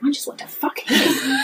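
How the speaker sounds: high, breaking into laughter; squeaky, half-voiced outburst; scandalised confession. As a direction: blurt it out, voice giving way to laughter. Confesses absurd urge while laughing.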